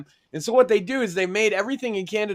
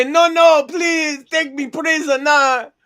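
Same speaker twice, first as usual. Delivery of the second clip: high, loud whiny mock surrender; a Frenchman begging to surrender, high and loud mock pleading; 6 semitones higher, 6 dB louder than usual. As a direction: ham up a begging coward giving in. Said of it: "They'll say".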